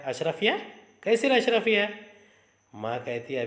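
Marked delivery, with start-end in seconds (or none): 0.0-1.9 much higher, louder astonished how-come; pricked up by the word gold; startled question. Acted. Son, jolted by mention of gold coins, asks in shock. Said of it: "Ashrafi को जिक्र पर चौंकता है".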